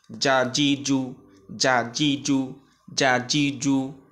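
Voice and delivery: "যা, জি, জু" as three short even syllables; quick short vowels. Shown as a demonstration. Teacher reading all three together for learners, short "যা, জি, জু". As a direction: drill "যা, জি, জু" crisply, no stretching. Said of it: "উচ্চারণ দ্রুত হয়".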